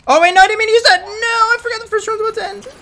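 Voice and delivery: loud, very high distressed yelling; panicked wail. Real, not acted. Player who didn't mean to use that, panicked wail. Player misuses reward by mistake.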